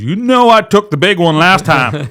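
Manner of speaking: acted-out squabbling; mock argument. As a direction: retort loudly as an indignant mate. Acting out mates arguing over girls.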